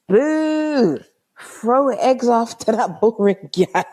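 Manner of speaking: high, drawn-out mock boo; playful high-pitched jeer. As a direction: heckle like a scornful crowd. Acting out a jeering carnival heckle.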